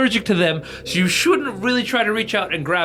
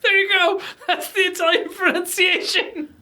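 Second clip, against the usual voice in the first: high, laughing speech; 12 semitones higher than usual. Real, not acted. To co-hosts after the pronunciation clip, laughing. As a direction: squeeze the words out through real laughter.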